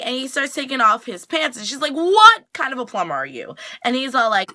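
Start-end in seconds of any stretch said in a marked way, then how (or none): 1.9-3.6 loud, higher mock outrage, hard on the first word; incredulous outburst. She sees the supposed plumber take off his pants, incredulous. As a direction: challenge him in exaggerated disbelief. Retelling an acted confrontation.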